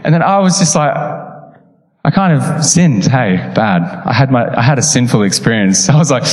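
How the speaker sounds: normal talking voice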